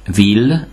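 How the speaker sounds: ville ending in a clear L; French ville, L sounded; ILL with L. Shown as a demonstration. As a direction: say ville as "veel". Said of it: "I L L betűcsoportot ill-nek ejtjük".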